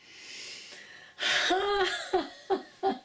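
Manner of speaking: high, loud laugh of surprise and admiration; surprised, admiring laugh. Shown as a demonstration. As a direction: laugh as if amazed and delighted. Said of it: "an ah of surprise and admiration".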